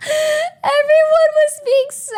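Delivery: fighting back happy tears; choking up. Real, not acted. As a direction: voice breaking with emotion. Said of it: "I'm gonna cry already".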